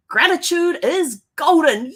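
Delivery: loud, high, drawn-out episode title; triumphant title announcement. Acted. Host announcing the episode title, loud and triumphant. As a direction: proclaim it slowly and loudly, pitch swooping.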